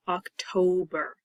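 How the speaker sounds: OCTOBER slowly as oc-to-ber; slow, clearly divided October; short then long. Shown as a demonstration. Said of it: "it makes the vowel short".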